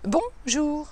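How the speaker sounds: "bonjour" with the weight on "bon", the "jour" lighter; stress first syllable. Shown as a demonstration. Coach showing viewers a bonjour stressed on "bon". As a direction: punch "bon" in "bonjour", let "jour" fall away. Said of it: "en insistant sur la première syllabe".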